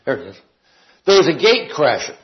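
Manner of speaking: ordinary talk, nothing marked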